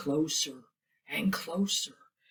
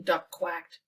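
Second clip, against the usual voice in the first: ordinary delivery, nothing marked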